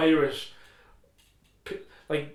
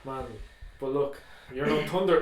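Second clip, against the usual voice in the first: ordinary delivery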